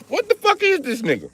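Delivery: mock bewildered shriek; baffled squeal. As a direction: pitch leaps very high in confusion. Confused onlooker in the bit.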